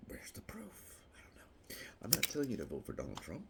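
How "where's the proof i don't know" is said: whisper. Acted. Whispered aside.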